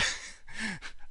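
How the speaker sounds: breathy burst of laughter; laughing. Real, not acted. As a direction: laugh at yourself. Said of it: "thank you".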